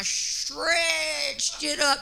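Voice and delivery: acted effort, slowly pulled long; strained stretching. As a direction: stretch the word out as if pulling elastic. Acts out stretching the garment.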